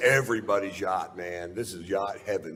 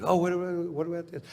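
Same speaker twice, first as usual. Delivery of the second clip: eager fan; 11 semitones higher than usual. Retold or imitated. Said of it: "they're just".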